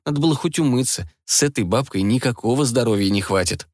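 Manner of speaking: usual speaking voice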